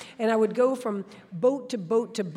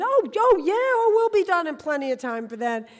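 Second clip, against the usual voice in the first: eager reply; 9 semitones higher, 10 dB louder than usual. Retold or imitated. Eager young swimmer reassuring Ed.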